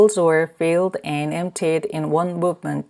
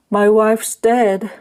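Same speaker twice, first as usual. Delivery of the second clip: grieving; 4 semitones higher, 4 dB louder, slower than usual. A narrator's reading. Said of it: "he said".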